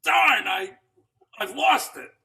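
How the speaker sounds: loud, high frustrated yell; frustrated shout. Retold or imitated. Comic on stage loudly cursing his lost edge. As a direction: blurt it out in loud dismay. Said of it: "he would get up there and go".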